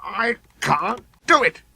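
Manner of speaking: high, slow defeated grumble; grumbling engine. A narrator's reading. Sir Handel stuck on the hill. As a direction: moan it out in frustration. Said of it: "he grumbled".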